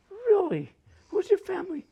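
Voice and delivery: high, surprised question; lightly voicing a curious girl. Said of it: "She goes".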